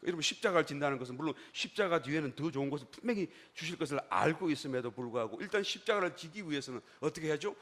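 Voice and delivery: ordinary talk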